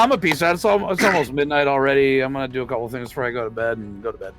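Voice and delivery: ordinary talk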